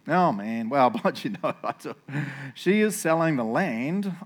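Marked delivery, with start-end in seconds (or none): 2.5-4.1 slow, drawn-out indifference; who-cares nonchalance. Shown as a demonstration. Boaz mentions land for sale to the redeemer, nonchalant. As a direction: draw it out as if bored by it. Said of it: "I'll read it in the who really cares way".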